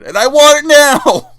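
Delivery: high, very loud demanding yell; mock tantrum shout. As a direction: bellow it like a spoiled brat's demand. Mock tantrum reading the complaint.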